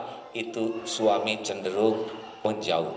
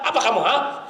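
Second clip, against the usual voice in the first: mock angry shout; 11 semitones higher, 4 dB louder, faster than usual. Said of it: "orang marah itu biasanya suaranya keras".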